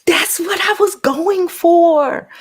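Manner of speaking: high, slow, airy new voice put on for the chat. Showing off her new voice to the livestream chat, high and slow. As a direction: glide up high and stretch it out. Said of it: "New voice".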